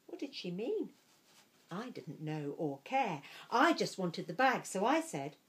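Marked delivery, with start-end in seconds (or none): none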